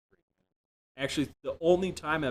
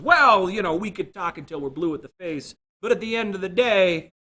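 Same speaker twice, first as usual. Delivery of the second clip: higher, louder, pompous noncommittal voice; mock platitude; 6 semitones higher, 6 dB louder than usual. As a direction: sound wise while saying nothing. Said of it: "you start throwing out platitudes".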